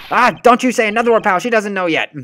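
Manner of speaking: high, loud, fast scolding; frantic shushing; urgent hush. Acted. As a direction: stop him blurting out the news. Voicing character hushing partner.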